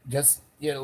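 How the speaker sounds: usual speaking voice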